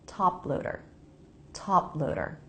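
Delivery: "top loader" joined, P unreleased straight into the L. Shown as a demonstration. Teacher modelling for learners, "top loader" with the P unreleased. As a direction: say "top loader" with no puff after the P. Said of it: "I just go into the L sound".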